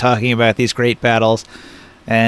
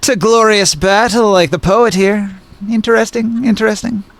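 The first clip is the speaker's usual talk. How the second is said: put-on southern Irish accent; 5 semitones higher than usual. Woman weighs up the newcomers' call to battle, southern Irish accent. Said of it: "it sounded like a southern Irish accent".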